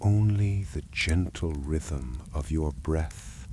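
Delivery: ordinary delivery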